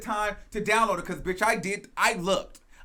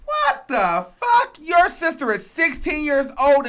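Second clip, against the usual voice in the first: outraged disbelief; 6 semitones higher, 6 dB louder than usual. Real, not acted. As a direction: drag it out, stunned and outraged. Reacting to sister-stripping remark.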